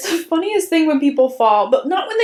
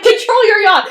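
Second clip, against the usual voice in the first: mock scold; 8 semitones higher, 5 dB louder than usual. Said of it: "And I'm like".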